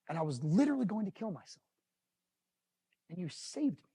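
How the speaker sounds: softly retold, moved by the message; subdued, emotional retelling; getting emotional. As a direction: tell it quietly, holding back emotion. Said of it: "I'm emotional".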